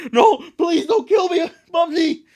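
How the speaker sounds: high, loud begging; loud, high-pitched cartoon panic; mock terrified plea. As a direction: shriek for mercy, hammed up. Acted plea for life.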